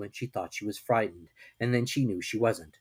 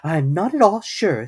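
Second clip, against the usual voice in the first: slow, higher, louder musing mother; thoughtful; 7 semitones higher, 7 dB louder than usual. A narrator's reading. Kanga to Piglet, thinking about a cold bath. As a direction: ponder it aloud, slowly. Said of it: "in a thoughtful voice".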